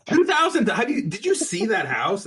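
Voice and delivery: shocked high yelp; can't believe the number; disbelieving. Real, not acted. Disbelief at the price.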